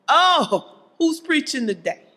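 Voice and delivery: lightly acted fussy church member; picky churchgoer. Preacher mimicking Christians caught up in logistics, lightly acted. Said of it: "we as Christians, we kinda get caught up".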